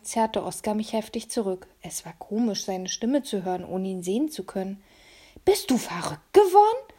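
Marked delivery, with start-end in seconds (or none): 5.4-6.9 put-on high, loud, hissed child voice; hissing boy. A narrator's reading. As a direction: hiss it as a panicked boy. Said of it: "zischte er".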